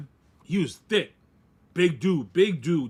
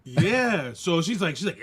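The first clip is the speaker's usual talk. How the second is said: normal talking voice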